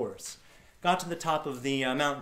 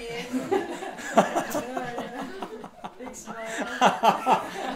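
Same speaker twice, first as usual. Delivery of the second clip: long laugh; 10 semitones higher than usual. Real, not acted. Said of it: "long laugh".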